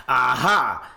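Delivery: spring it like catching someone out; mock aha. Mocking the slip with a loud gotcha. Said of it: "something about it that just goes".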